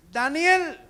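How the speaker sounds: high, loud cry of a name; king's anguished call; anguished shout. Acted. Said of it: "Gritó con angustia".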